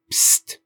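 whispered psst to get attention; hiss. A narrator's reading. Grandpa sneaking at night.

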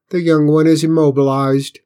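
alien voice. A narrator's reading. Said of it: "the alien said".

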